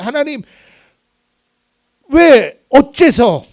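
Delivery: loud, high cry of why; anguished prayer cry. Pretend. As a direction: cry out to God for his people. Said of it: "이런 기도 많이 했어요". (성도를 위해 울부짖는 기도)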